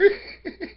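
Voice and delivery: short high burst of real laughter; high giggle. Real, not acted. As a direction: giggle at your own joke. Laughs at own joke.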